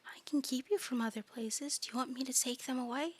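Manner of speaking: soft, whispery eldritch coo; hushed, tender monster voice; eerie doting creature. Acted. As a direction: coo softly and sweetly from the dark.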